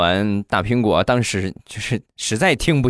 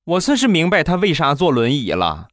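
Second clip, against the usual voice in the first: put-on higher, brash girl's voice; bold young woman; 7 semitones higher than usual. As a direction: play the outspoken girl mocking the man. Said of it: "性格特别豪爽的妹子就说了".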